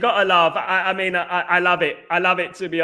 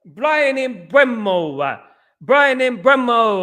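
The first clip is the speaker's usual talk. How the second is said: slow, drawn-out accented announcer impression; Fabrizio Romano accent; 4 semitones higher, much slower than usual. Shown as a demonstration. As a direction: stretch each word out in a put-on foreign accent. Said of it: "can you do an accent".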